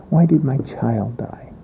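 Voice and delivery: normal talking voice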